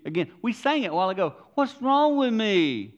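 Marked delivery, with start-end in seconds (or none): 1.6-2.9 high, loud, slow wail of "wrong"; anguished cry. Retold or imitated. A hurting believer crying out high and loud.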